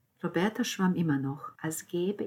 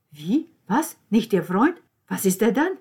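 higher, louder disbelieving questions; surprised; 4 semitones higher, 12 dB louder than usual. A narrator's reading. Niccolò learns the breakup news, stunned and surprised. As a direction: caught off guard, demand answers. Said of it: "Vor Überraschung".

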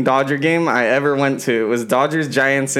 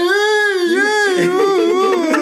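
high, whiny begging cry; calling for ball; 3 dB louder, much slower than usual. Retold or imitated. Friend imitates Grumpy begging a player for the ball, high whiny call. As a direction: squeal for the ball like a needy fan. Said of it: "he's like".

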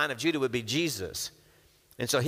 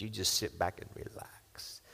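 soft whispered pitch; 6 semitones lower, 9 dB quieter than usual. Acted. Brothers selling Jacob on the comfy ride, whispered. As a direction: coax him to relax in a whisper. Luxury car sales pitch.

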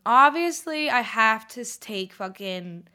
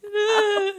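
mock sob; 12 semitones higher, 6 dB louder than usual. [name]'s crying reaction.

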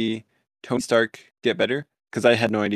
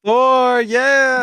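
loud, high, drawn-out cheer; excited cheer; 13 semitones higher, 9 dB louder than usual. Real, not acted. Co-host celebrating Thor's arrival, excited cheer. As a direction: cheer it at the top of your range. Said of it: "we're excited to".

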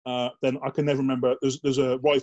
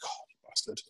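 whispered curse; 12 dB quieter than usual. Retold or imitated. Muttered curse at surprise exercise.

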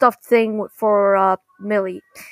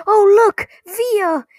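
high, loud excited exclamation; imitating an excited cartoon character; excited call; 10 semitones higher, 5 dB louder, much slower than usual. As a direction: call out in mock delight to get someone's attention. Acting a father's excited pointing.

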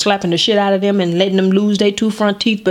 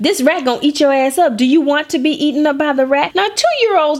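normal talking voice

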